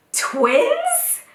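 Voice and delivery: very high, incredulous question; astonished husband. Retold or imitated. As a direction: imitate a stunned, squeaky-high reaction. Husband's shocked discovery retold.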